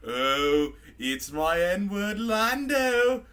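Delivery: very slow, drawn-out rising greeting; tipsy British droid's slow hail; drunk C-3PO. Acted. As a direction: stretch the words out in mock delight, climbing. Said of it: "He's all like".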